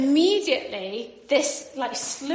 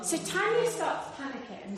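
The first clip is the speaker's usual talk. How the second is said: normal talking voice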